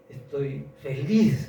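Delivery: joyful declaration. Retold or imitated. Healed woman rejoicing.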